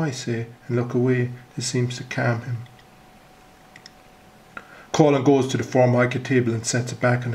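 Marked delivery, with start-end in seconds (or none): none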